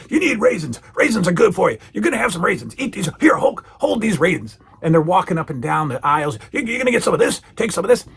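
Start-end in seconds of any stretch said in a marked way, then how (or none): 0.1-4.6 higher-pitched, pushy celebrity impression; Mister T. Mister T grabbing food off shelves for Hulk, bossy impression. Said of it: "Mister T is running this skit". 6.5-8.1 high, insistent celebrity impression; Mister T. Mister T walking the aisles with Hulk, pushy impression. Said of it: "Mister T is running this skit".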